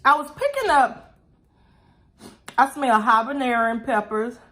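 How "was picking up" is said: coughing while talking. Real, not acted. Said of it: "corn kernels went down the wrong pipe".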